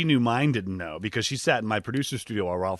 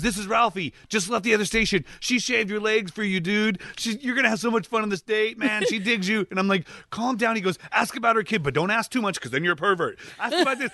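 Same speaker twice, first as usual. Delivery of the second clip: a bit higher, pal-to-pal coaching; lightly voiced Ralphie; 9 semitones higher than usual. Comedian on the phone, lightly hyped buddy advice. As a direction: hype up your buddy.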